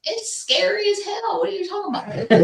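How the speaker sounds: high squealing laugh while talking. Real, not acted. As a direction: laugh through the words, pitch way up. Laughing protest about scary footage.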